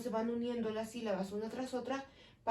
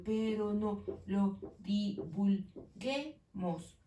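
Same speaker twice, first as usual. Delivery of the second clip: slow syllable count; much slower than usual. Shown as a demonstration. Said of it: "Son ocho sílabas".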